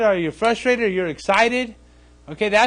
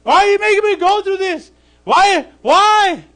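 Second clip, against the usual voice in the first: much higher and louder, exasperated; whining at God; 11 semitones higher, 7 dB louder than usual. Pretend. Believers in trouble, crying out to God: loud exasperated why. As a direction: cry out why, again and again. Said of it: "That's usually what we do".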